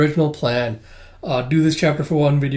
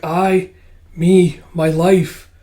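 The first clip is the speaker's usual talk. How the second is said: raised, emphatic protest; acted insistence; 4 semitones higher, 5 dB louder, much slower than usual. Tuya claiming her own life, insistent. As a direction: claim each word as your own.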